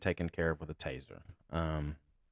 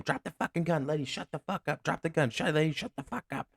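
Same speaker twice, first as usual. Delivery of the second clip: raised-pitch retold yell, held back in volume; mock yelling; 8 semitones higher than usual. Officer ordering armed man and screaming lady, imitated yelling. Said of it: "I'm yelling at him".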